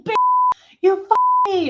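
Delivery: retold rage, shouted high and loud; screaming curses down at her on the floor; furious curse. Man screaming curses at the woman he attacked, furious. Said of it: "Dan is like".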